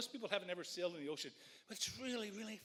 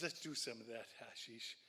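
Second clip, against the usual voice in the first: put-on Dutch accent; 8 semitones lower, 6 dB quieter than usual. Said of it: "with his Dutch accent".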